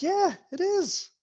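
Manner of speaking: very high, slow squeal of delight; gushing barmaid. Acted. Barmaid insisting to her husband, squealing. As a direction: stretch it out, thrilled.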